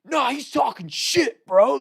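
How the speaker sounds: high, slow mock yell; retold screaming. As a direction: protest like an indignant frat bro. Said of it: "They start screaming all this stuff at us".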